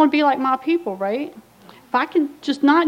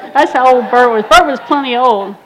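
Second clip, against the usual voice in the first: much louder comic payoff; loud punchline; 11 dB louder than usual. Real, not acted. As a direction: land the joke with a big loud push. Punchline about ancient sponsor.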